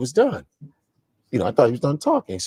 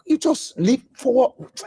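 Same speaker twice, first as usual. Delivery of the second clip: high, slow Nigerian dad demand; slow indignant father, voice pitched up; 9 semitones higher, much slower than usual. Retold or imitated. Father questioning his son, indignant. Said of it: "it was like".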